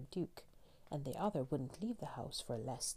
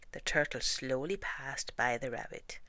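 ordinary delivery

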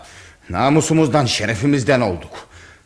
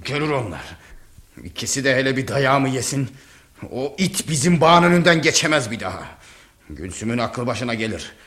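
ordinary talk

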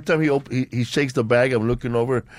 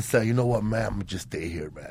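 much softer, lower and defeated; resigned give-up; 3 semitones lower, 7 dB quieter than usual. Retold or imitated. Telling his party buddy he's staying, quietly giving in. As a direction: let the fight go out of it, quietly. Gives up on the flight.

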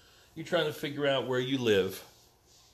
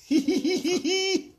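very high pulsing laugh; squealed happy laugh at a dog; high giggle. Real, not acted. Delighting in the dog.

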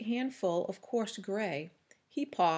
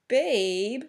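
scornful, louder "Babe?" with a sneering rise; contemptuous question; 3 semitones higher, 6 dB louder than usual. A narrator's reading. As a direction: spit "Babe?" back in disbelief. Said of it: "His voice was contemptuous".

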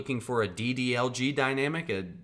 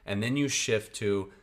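normal talking voice

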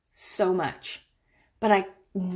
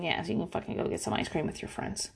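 breathy whispered aside, granting permission; mock hushed permission; 4 semitones lower, 11 dB quieter, faster than usual. Mom whispering to her teen that she can go. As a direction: let it slip out under your breath.

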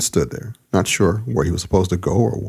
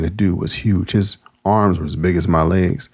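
ordinary delivery, nothing marked

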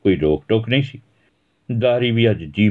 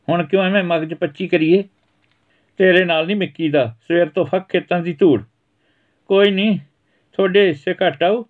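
bickering, raised voice; 10 semitones higher than usual. Acted. Mother and son trading jabs, tart and raised. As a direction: snap back across the room.

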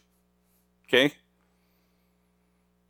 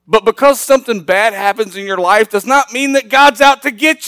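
higher and louder, pushed sermon voice; fiery preaching; 12 semitones higher, 7 dB louder than usual. Acted. Pastor preaching to the congregation, higher and louder. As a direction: preach it hard to the back row.

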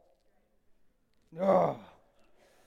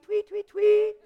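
bird chirp imitation; 11 semitones higher, slower than usual.

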